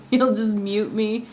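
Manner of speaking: ordinary talk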